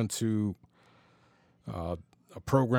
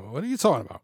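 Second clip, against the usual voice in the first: higher-pitched, puzzled child's question, lightly imitated; a touch of baffled kid, pitched up; bewildered; 4 semitones higher, much faster than usual. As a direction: mimic a small son's bewilderment. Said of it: "with this look of bewilderment".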